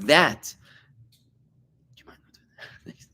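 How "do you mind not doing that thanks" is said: hushed request to stop; quick real whisper asking someone to stop; whispered aside. Real, not acted. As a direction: whisper it off to the side to one listener. Asks listener to stop clicking pen.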